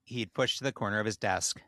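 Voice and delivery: measured storyteller reading; measured storyteller reading a tale aloud; story narration. A narrator's reading.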